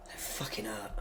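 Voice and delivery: whispered swear, almost voiceless; hushed swear. Real, not acted. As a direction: breathe the swear out under your breath. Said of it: "Oh, sorry".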